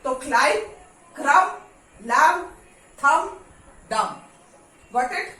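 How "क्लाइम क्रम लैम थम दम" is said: each word spoken with b silent, louder. Shown as a demonstration. Teacher drilling students on silent-b words, spaced and loud. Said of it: "B is silent".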